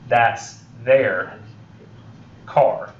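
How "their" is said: their with a slight I sound; in-between vowel. Shown as a demonstration. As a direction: say their between there and they-er. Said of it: "pronouncing the I slightly somewhere in between".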